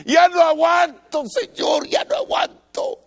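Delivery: loud high plea that breaks down to a whisper; desperate cry. Acted. Drowning believer begging God, shouting then whispering. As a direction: beg as if out of strength.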